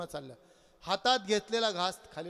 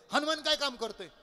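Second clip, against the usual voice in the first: blurted question; 7 semitones higher than usual. Acted. Kalyan's blurted question, higher take.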